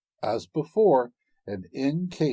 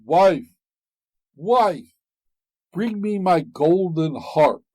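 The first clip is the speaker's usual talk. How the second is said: loud ogre call; 6 semitones higher, 6 dB louder, slower than usual. A narrator's reading. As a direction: boom it across the room. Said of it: "the ogre called out".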